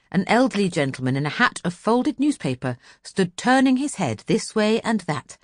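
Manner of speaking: usual speaking voice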